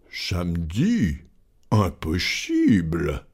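low, slow, soft disbelief; composed gentleman's low, slow voice; unruffled master. Acted. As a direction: draw each word out coolly and quietly. Calm master disbelieves servant.

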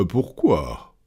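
low, composed question; unruffled master. Acted. Calm master questions servant.

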